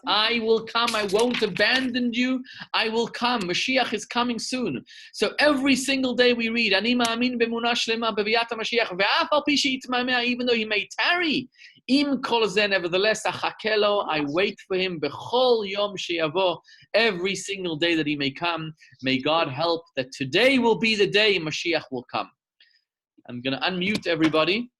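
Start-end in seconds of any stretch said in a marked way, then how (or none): 0.1-3.4 fervent preacher's vow; rising fervent promise. Acted. God's promise, second telling. 3.4-22.3 raised, ringing preacher's voice; impassioned sermon finale; fervent preaching. Acted. Closes sermon with fervent prayer.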